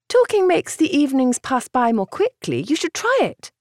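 light, coaxing sister voice; young woman voice. A narrator's reading. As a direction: voice the gentle sister. Cassandra voiced in audiobook.

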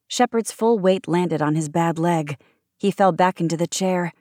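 usual speaking voice

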